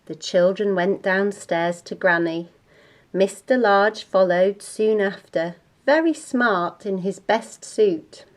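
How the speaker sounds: ordinary delivery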